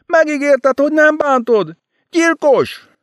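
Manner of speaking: screaming. Acted. Enid screams murderer at the narrator, shrieking high. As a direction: scream the accusation at him.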